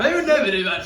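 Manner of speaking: hammed-up shocked denial; mock outrage on never; indignant.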